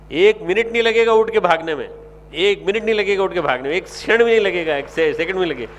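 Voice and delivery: forceful sermon emphasis; emphatic preaching. Real, not acted. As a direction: drive the point home. Preacher emphasizing quick rise.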